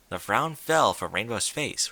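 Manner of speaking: ordinary delivery, nothing marked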